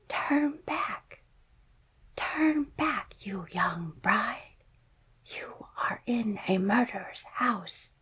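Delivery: whispering bird. Acted. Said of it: "a voice called out".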